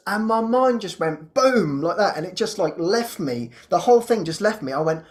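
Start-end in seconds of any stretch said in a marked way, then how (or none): none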